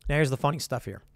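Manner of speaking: ordinary delivery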